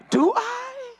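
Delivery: high, rising, stretched-out question; mock doubt. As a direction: question yourself in a high squeak. Sudden doubt during prayer.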